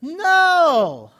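sharp, high, loud cry of "No!"; emphatic no. Acted. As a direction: shout the correction to the congregation. Preacher rejects the wrong reading.

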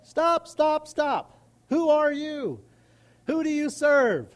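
stern inner Spirit. Retold or imitated. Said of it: "The Spirit within me begins to say".

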